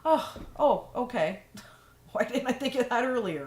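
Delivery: mock delighted surprise, laughing; pleasantly surprised. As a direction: act out the happy aha moment with a laugh. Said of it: "you'll be like".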